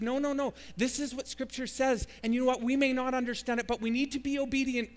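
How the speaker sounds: high, urgent overruling of an objection; earnest, emphatic persuasion; acted insistence. Acted sister insisting on obedience.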